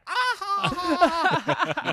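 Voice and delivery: high cackling laugh; imitating a loud high cackle; biker woman's cackle. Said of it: "the girl biker was like".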